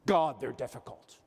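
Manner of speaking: a hint of a cranky older manager; mock complainer. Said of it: "I hear people say all the time".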